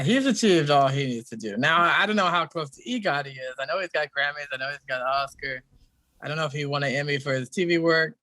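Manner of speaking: normal talking voice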